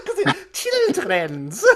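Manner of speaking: high, drawn-out joke voice through laughter; silly mock voice. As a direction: stretch the word in a squeaky, giggly put-on voice. Joking repeat of the word children's.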